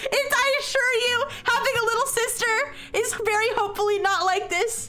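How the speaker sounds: real exasperated outburst. Real, not acted. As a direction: protest in exasperation. Loud protest at the plot.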